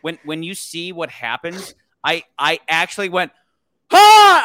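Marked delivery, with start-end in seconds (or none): 3.9-4.4 sudden high, loud shout of shock; startled yell. Retold or imitated. Recalling his reaction in the cinema, a sudden shocked yell. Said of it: "I actually yelled".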